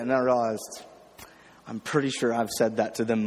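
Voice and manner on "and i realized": usual speaking voice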